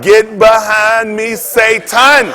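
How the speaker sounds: loud, drawn-out shout at the devil; mock rebuke. Telling how he rebuked Satan on the phone, loud rebuke. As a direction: cast him out at full voice, slowly.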